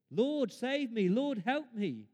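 loud, high, drawn-out plea; crying out to God. Acted. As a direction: call out for rescue. Voicing people crying to God.

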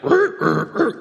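high, laughing imitation of a rocking, creaking boat; creaking boat noise. Preacher mimics the storm-tossed boat, creaking and laughing. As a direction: rasp out the groaning boat with a laugh. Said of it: "I'm just thinking of the boat".

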